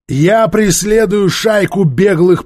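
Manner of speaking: high, loud, slow declaration; cutting in. A narrator's reading. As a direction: override the speaker with force. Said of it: "Трок оборвал его".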